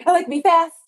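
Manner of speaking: quick, high, loud burst; acting out fast. Teacher acts out being fast for children, quick burst. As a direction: fire it off in one rapid blurt.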